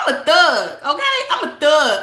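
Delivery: usual speaking voice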